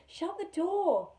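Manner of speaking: loud, slow, high cry to shut the door; frightened girl yelling at the woman; urgent shout. Acted. Girl begging the woman in the doorway, loud frightened cry. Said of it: "She said".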